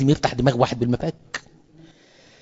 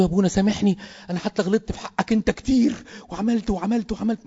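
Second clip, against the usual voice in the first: much higher voice, fading softer to the end; pleading for forgiveness; 8 semitones higher, 3 dB quieter than usual. Acted. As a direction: beg forgiveness, trailing off softer each time. Dying priest begging forgiveness. (رجاء متوسل بصوت عالي يخفت تدريجيا)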